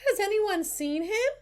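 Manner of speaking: high-pitched teacher voice; teacher questioning class. A narrator's reading. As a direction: ask the whole class, high and searching. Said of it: "she announced".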